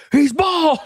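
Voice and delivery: high, loud laughing cry; real glee, yelled through laughter; laughing shout. Real, not acted. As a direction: blurt it out cracking up. Cracking up at a picture.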